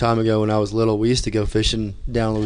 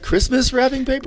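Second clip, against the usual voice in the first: high, mocking squeak of disbelief; squeaky mock-disbelief at the wrapping paper; mock incredulous. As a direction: pitch it way up in fake shock. Mocking question about wrapping paper.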